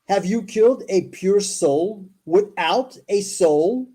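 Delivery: slow, weighty read-aloud; scripture reading. Acted. Host reading Moses's question from scripture, slow recital. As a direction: spell out each phrase deliberately.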